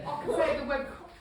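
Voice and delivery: loud, high mock Cockney; Cockney accent. Shown as a demonstration. Speaker tries Cockney to get the hard word out, mock Cockney accent. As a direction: put on a jokey Cockney accent. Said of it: "If I just go Cockney".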